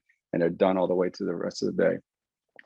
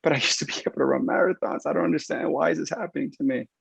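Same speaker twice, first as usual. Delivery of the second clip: protesting patient; 7 semitones higher than usual. Retold or imitated. Injured runner protesting to the clinician, higher mock whine. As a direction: push back like a baffled runner.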